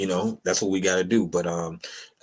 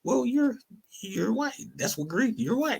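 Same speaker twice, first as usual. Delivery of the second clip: high mock retort; 14 semitones higher, 3 dB quieter than usual. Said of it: "be like".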